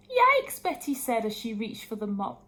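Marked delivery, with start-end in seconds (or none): 0.1-0.6 high, loud startled yelp; alarmed. A narrator's reading. Betty yelping as goo spills onto the floor. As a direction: yelp in fright. Brew pours over floor.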